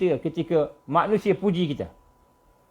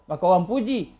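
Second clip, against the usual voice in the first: normal talking voice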